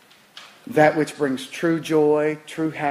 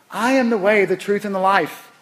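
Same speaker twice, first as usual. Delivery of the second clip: raised, louder declaration of a quote; emphatic repeat; 4 semitones higher, 3 dB louder than usual. Retold or imitated. Preacher repeats Christ's words to the congregation, emphatic proclamation. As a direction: proclaim it with conviction.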